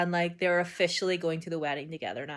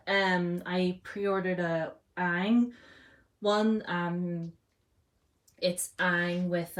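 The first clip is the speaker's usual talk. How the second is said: ordinary talk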